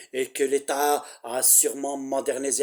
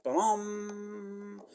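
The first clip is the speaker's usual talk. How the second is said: soft, held "plam" on one high note; sustained electronic ding done by mouth; imitated announcement chime; 5 semitones higher, 8 dB quieter than usual. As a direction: mimic a public-address chime, quietly. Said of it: "la voix robotique qui annonce".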